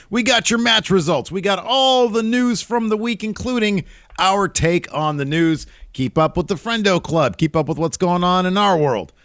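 high-pitched, upbeat podcast ad pitch; promo plug. Acted. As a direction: sell the newsletter like an excited pitchman. Plugs the show's newsletter.

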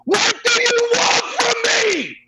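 very high, strained scream of desperation; anguished yell. Retold or imitated. Man alone at night yelling out, desperate scream. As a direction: cry it out at the top of the voice. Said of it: "And I yelled".

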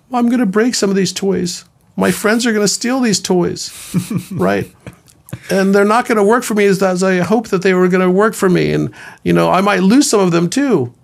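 put-on high childlike voice, worried and complaining; whiny little boy. Pessimistic boy whining to the psychiatrist in a high child voice. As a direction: play the gloomy five-year-old fretting over his toys.

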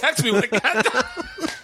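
high, drawn-out laughing repeat. Real, not acted. Comedian echoes his friend's joke about the cat, cracking up. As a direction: squeal the line through laughter.

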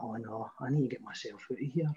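soft, worried aside; quiet panicked thought. Retold or imitated. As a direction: murmur it, desperate to escape. Panicked thought during ramble.

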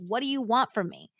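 normal talking voice

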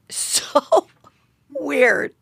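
breathy little laugh into a whisper; amused whisper of disbelief; whispered aside. Real, not acted. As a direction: lean in and whisper "So weird" in disbelief. Whispered reaction to troll story.

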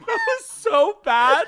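high, slow, through real laughter; helpless laughter. Real, not acted. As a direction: struggle to speak while cracking up. Recovering from gagging, laughing helplessly.

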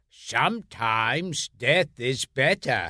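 slow, low New England drawl; a hint of New England accent, low and slow. Quoting Pet Sematary in a low, slow New England accent. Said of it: "he's got the New England accent".

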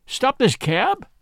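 a slow, high, alarmed echo of her request; alarmed cry. A narrator's reading. A young man hears he must stop the cab, slow high alarmed cry. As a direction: cry it back in disbelief. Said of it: "he cried".

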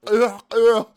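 loud, pained ugh of disgust; mock-agonised ugh ugh ugh; disgusted groans. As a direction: groan out each ugh like it hurts. Groaning at the soda burn.

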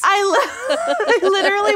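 laughing. Real, not acted. Laughing about Monday.

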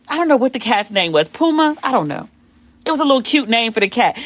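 usual speaking voice

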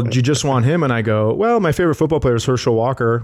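ordinary delivery

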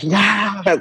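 mock cheer. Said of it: "So everyone was like".

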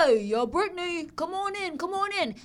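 high-pitched, hammed-up Guido greeting; Guido guy. Sleazy doctor welcoming a teenage patient, hammed-up Guido. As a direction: imitate a Guido calling out a welcome. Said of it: "imagine the most Guido guy".

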